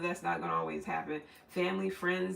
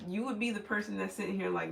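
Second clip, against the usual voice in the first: ordinary talk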